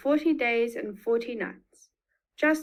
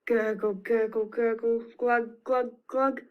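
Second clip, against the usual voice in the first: draining water sounds. Shown as a demonstration. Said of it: "like the sound of a bath".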